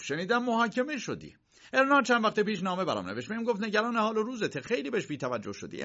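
high-voiced, hurried uncle. Uncle telling Josef about Erna's letter, high and hurried. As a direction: rush through the news.

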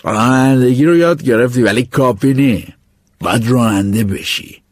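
unhurried, weighty advice; teacher giving his last lesson, slow and grave; slow old teacher. Acted. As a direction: lean on each word like a mentor. Teacher gives final advice.

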